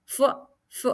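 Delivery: फ said alone as a short f sound; phonic sound demo. Shown as a demonstration. Teacher drilling फ for students. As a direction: isolate the फ sound. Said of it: "फ का sound आ रहा है".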